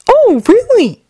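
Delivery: high, too-casual denial; innocent teen voice. Acted. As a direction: play dumb, brightly. Izuku lying innocently.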